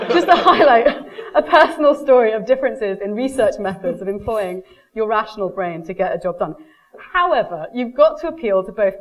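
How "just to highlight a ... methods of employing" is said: louder, still laughing through the words; giggling after telling the husband story. Real, not acted.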